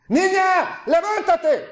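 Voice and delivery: high-pitched commanding call; shouted command. Retold or imitated. Preacher re-enacting the Messiah raising the girl, shouted. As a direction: cry it out to wake the dead. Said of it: "Gritó".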